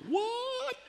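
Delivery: high, stunned disbelief; shocked what. Retold or imitated. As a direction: gasp it out in shock. Moses hears he's not going.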